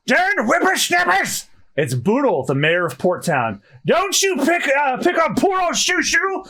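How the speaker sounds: put-on high cranky old man scolding; grumpy old mayor.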